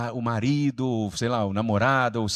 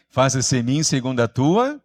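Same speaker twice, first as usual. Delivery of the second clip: louder call with a rising, unfinished end; rally the group to answer with more force; stronger prompting; 6 dB louder than usual. Shown as a demonstration. Preacher prompting the congregation after a weak response, stronger call. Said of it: "tá meio fraco, hein?".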